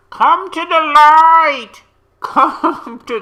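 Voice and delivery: high, loud Poltergeist imitation; hammed-up movie line. Vlogger quoting Poltergeist about the light behind, hammed-up calling. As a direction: call out slowly and loudly, hammed-up. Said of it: "it's poltergeist".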